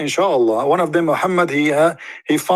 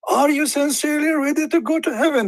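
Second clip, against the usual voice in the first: much higher, lilting teasing question; the Prophet asking; 12 semitones higher than usual. Retold or imitated. The Prophet teasing the follower, high and lilting. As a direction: dangle the question like a prize. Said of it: "The Muhammad keeps saying that".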